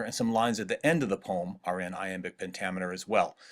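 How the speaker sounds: ordinary talk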